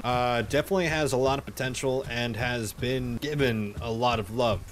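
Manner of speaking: usual speaking voice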